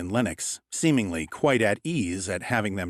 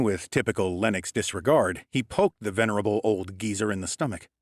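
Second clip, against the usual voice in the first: plain reading voice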